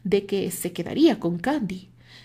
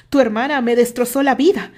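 much louder, pained yell; loud accusing shout; 4 semitones higher, 10 dB louder than usual. A narrator's reading. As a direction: throw it in his face. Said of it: "le gritó Terry".

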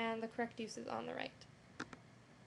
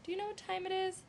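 higher, rising question read; 8 semitones higher, faster than usual. Shown as a demonstration. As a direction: ask it like you need the time. Demo of asking a question.

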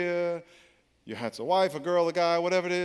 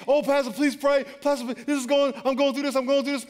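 whiny pleading member, pitched higher and louder; pleading congregant; 9 semitones higher, 4 dB louder than usual. Retold or imitated. Church member begging the pastor for prayer, whiny plea. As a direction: beg the pastor like a desperate churchgoer.

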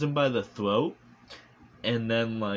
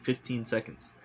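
ordinary talk

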